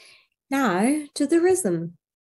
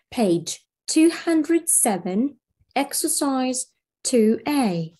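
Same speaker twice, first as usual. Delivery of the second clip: slow repeat; 3 semitones higher, slower than usual. Shown as a demonstration. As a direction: slowly repeat the page for the class. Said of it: "teacher repeats the page".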